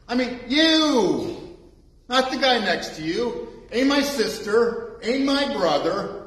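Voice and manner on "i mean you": "you" slow, high and stressed; single out the listener with "you"; pointed you. Shown as a demonstration. Preacher pointing at each listener, slow emphatic you. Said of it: "the you is you personally".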